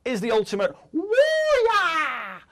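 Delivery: loud, slow, high ring announcer call; announcer shout. Acted. Announcing top entry.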